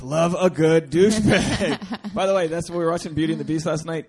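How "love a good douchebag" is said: slow, loud laugh through words. Real, not acted. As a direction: laugh it out slowly. Laughing agreement.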